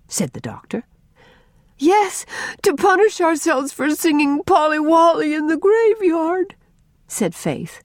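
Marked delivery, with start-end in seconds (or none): 1.8-6.5 put-on very high, insistent child voice; high-pitched earnest girl; overwrought little girl. A narrator's reading. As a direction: blurt the confession, drawing out the end. Child blurts out her confession.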